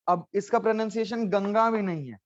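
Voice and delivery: ordinary delivery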